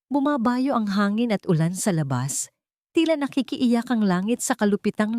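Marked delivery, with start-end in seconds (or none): none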